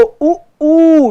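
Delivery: उ clipped short, ऊ held long and high. Shown as a demonstration. Teacher contrasting short and long vowels, high and loud. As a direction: contrast उ and ऊ by length. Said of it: "ध्यान दो".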